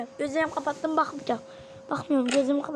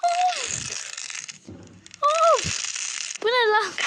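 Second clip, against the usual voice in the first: much higher, louder startled yelps; startled cry; 10 semitones higher, 3 dB louder, much slower than usual. Real, not acted. Vlogger jolted by the clip, yelping loud. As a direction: yelp in shock at what you see.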